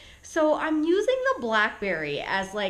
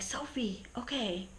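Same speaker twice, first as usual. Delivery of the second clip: much softer, as if to a pet; hushed confiding; 11 dB quieter than usual. Retold or imitated. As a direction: drop right down to confide in the dog. Confiding in her dog, retold.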